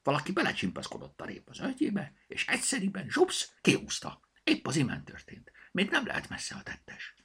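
high, airy little bunny voice; small rabbit's secretive stage whisper. A narrator's reading. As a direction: confide it in a tiny hushed squeak. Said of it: "suttogta Zorka".

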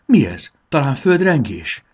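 high, loud alarmed question; startled. A narrator's reading. Bori feels the ground shake and asks, startled. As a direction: ask in sudden alarm. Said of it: "kérdezte riadtan Bori".

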